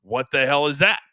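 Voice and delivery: firmer, fuller-voiced disbelief; retold blurted question. Captain confronting recruit about cash roll, blurted disbelief. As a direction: ask it outright, astonished.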